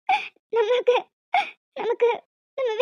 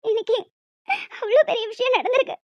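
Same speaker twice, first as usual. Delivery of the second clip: normal talking voice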